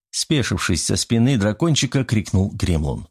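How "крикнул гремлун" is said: ordinary delivery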